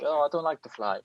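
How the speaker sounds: lightly voiced nervous Italian flyer; Giovinazzi, Italian accent. Nervous driver fearing planes, light Italian accent. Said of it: "That was an amazing accent".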